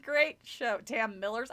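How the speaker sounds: ordinary delivery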